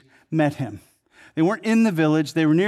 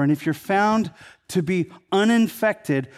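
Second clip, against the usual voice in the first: ordinary delivery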